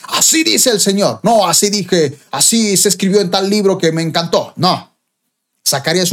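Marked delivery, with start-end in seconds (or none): 0.0-1.2 raised, slow, solemn proclamation; prophetic declaration. Shown as a demonstration. Preacher shows others how to preach, slow prophetic declaration. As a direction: declare it slowly as God's messenger. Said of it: "Cuando tú prediques, digas".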